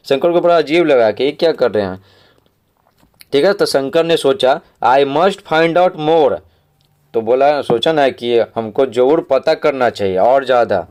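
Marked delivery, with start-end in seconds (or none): 4.9-6.4 very slow, every word spaced out for the class; slow read-aloud. Shown as a demonstration. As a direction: read the line slowly so pupils can follow. Teacher reads story passage slowly.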